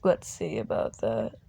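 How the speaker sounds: a quiet menacing promise; villain's low threat. Acted.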